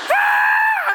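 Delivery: high terrified scream. Retold or imitated. Jumper screaming in freefall, high and terrified. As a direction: scream your lungs out falling. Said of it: "I'm screaming at the top of my lungs".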